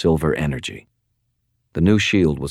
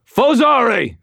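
high, loud snarled incantation; snarled spell; 6 dB louder, slower than usual. Acted. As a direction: hurl the spell word like a curse. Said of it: "and snarled".

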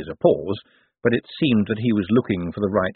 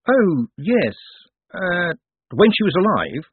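flustered Moist; 10 semitones higher, 4 dB louder, slower than usual. Acted. Moist caught off guard.

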